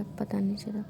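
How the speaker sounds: sheepish whispered aside. Real, not acted. Student admitting the break ran long, in a whisper. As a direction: breathe it out guiltily.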